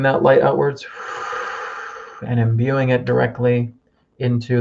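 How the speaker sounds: calm healing-circle guide; guided meditation. Acted. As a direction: lead them gently inward, breath by breath.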